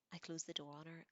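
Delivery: ordinary delivery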